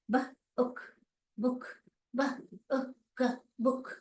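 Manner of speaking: ब, उक, बुक in short separate pieces; short vowel blend. Shown as a demonstration. Teacher showing right reading, short oo in बुक. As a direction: sound out बुक with a quick short oo. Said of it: "तो ये बुक है".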